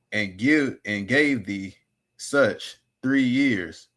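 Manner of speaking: measured scripture reading with drawn-out phrases; deliberate, phrase-by-phrase Bible reading. Real, not acted. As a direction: read the verse aloud slowly, pausing after each phrase. Reads scripture aloud.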